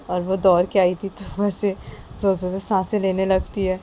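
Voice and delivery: plain reading voice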